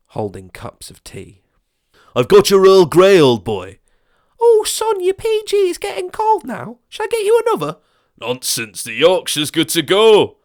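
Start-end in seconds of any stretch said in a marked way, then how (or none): 2.2-3.8 put-on higher, much louder jolly old chap; hearty gent. Crowd offering tea. 4.4-7.8 very high, fussing granny voice; fussing old lady. Acted. Neighbour offering tea. 8.2-10.4 blustering neighbour. Acted. Neighbour arguing teas.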